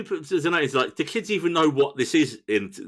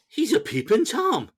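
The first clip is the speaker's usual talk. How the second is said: high, drawn-out film quote; slow high-pitched announcement of a scandal; mock discovery; 9 semitones higher, slower than usual. Quoting Marty spotting George, mock-shocked discovery.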